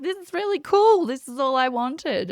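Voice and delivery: high and loud, thrilled; delighted. Retold or imitated. Telling the host about meeting her guides, high and thrilled. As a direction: burst out with joy.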